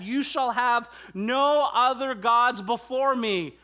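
first, already forceful take; emphatic quote. Shown as a demonstration. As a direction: lay the command down firmly.

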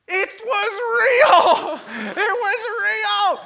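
high, very loud, drawn-out shout; hammed-up jubilant hollering; ecstatic usher. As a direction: scream the good news in amazement. Usher returns with confirmation.

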